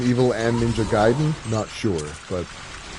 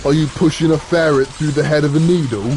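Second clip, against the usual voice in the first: British voice; 4 semitones higher than usual. Retold or imitated. Mock British quarrel voice.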